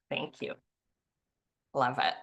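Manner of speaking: ordinary talk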